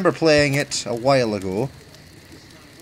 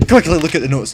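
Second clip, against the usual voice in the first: ordinary talk, nothing marked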